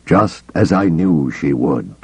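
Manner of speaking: usual speaking voice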